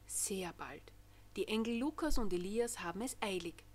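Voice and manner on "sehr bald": a soft secretive whisper. A narrator's reading. Narrator whispering to children that Christmas is near. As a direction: breathe it like a secret.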